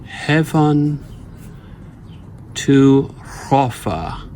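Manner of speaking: Heaven and hrofa spoken slowly, one metrical foot at a time; scanned foot by foot. Shown as a demonstration. Teacher scanning the first foot, slow and deliberate. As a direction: lay out each foot with long pauses. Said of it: "let's do the first foot".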